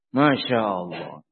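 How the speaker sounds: awed exclamation. Real, not acted.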